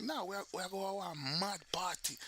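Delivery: Jamaican patois. Acted. Jamaican answering talk of a party tonight, Jamaican accent. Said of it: "they'll be like".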